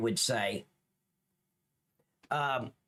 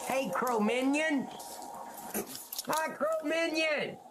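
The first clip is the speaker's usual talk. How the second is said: excited greeting; 12 semitones higher than usual. Real, not acted. Greeting a chat viewer excitedly.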